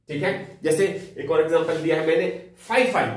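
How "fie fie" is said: disgusted exclamation. Shown as a demonstration. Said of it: "का मतलब है छी".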